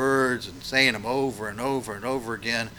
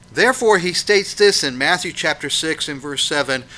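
higher, louder pulpit proclamation; full-force preaching; 5 semitones higher, 8 dB louder than usual. Acted. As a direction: drive the scripture home.

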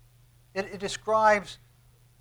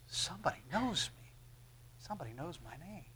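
very soft, marvelling quote; hushed wonder; 12 dB quieter than usual. Acted. Preacher voicing the woman realizing she is known, hushed wonder. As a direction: let it out softly, as if amazed.

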